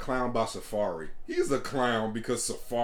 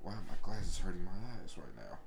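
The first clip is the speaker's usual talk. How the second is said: ordinary talk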